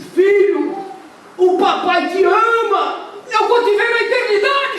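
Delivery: slow, high, loud broken farewell; tearful farewell. Acted. Fisherman bids his drowning son farewell, slow and tearful. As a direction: say goodbye to your son forever.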